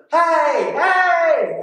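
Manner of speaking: high, loud call for attention; retold yell. Diver yelling to friends in the boat, high retold shout. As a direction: call out across the water to the boat. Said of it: "So I yelled at them".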